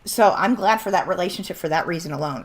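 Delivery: normal talking voice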